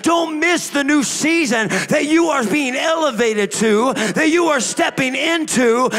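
loud, high, driving sermon delivery; full-force preaching. Real, not acted. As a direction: build to a sustained preacher's shout.

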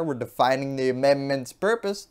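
ordinary delivery

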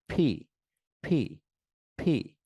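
ফি with both lips together, puffed like p; two-lip ph. Shown as a demonstration. Teacher drilling students on ফি, two-lip puffed sound. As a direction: blow ফি out between both lips, strong air. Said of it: "ওপর এবং নিচের ঠোঁট একসাথে".

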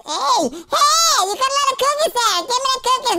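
high, rapid excited demand; greedy demand. Acted. Player spotting a lot of cookies, high excited demand. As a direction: squeal for it like a greedy raider.

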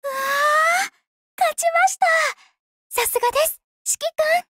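high, loud joyful cry of victory; excited cheer. Acted. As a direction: celebrate the win out loud. (高く大きな声で勝利を喜ぶ)

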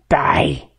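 very low, menacing character voice; menacing flower villain. Acted. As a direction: drop deep for a villain's death threat. Flowey threatens to kill the player.

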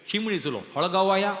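usual speaking voice

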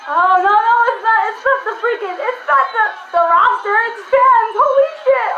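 high, loud, tumbling astonishment; amazed outburst. Real, not acted. Fan realising it's fans in the ring, amazed outburst. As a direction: exclaim in rising amazement.

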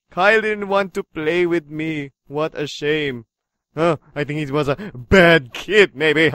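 low, quiet menacing villain. Acted. Game villain taunting about Kyle, low villain voice. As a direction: play a sinister villain, ending in a laugh.

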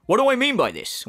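hammed-up quoted question, falling at the end; mocking catchphrase impression. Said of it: "great D S P memes, like the".

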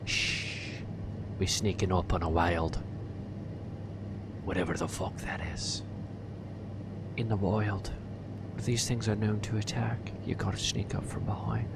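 soft nature-show narrator whisper; documentary whisper. Acted. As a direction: sneak up like a wildlife host.

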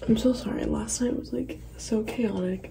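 hushed, raspy whisper. Real, not acted. Whispering vlog early morning.

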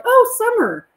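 high, sudden realization; Rodney reading her badge, mock surprised recognition. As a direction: act like the name just clicked. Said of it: "he's like".

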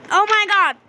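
loud, high startled cry; startled shout. Real, not acted. Finding friends suddenly in the dark, startled shout. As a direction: jump out of your skin.

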